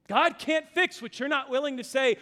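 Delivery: normal talking voice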